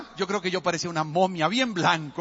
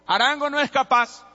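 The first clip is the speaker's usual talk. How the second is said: high, loud jeering voice of a doubter; a hint of a mocking onlooker daring the boy; taunting bystander; 8 semitones higher, 4 dB louder than usual. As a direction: sneer it like someone sure he'll fail.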